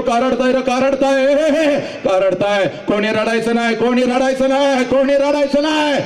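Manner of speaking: high, hearty call to the crowd; mock father calling out. Father calls wedding guests to stop crying, high call. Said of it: "सगळ्यांना ओरडतो".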